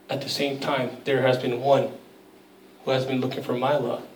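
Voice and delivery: a breathless whispered "oh my gosh", then soft and quiet; quiet wonder as the truth sinks in; hushed realization. Retold or imitated. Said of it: "she realizes".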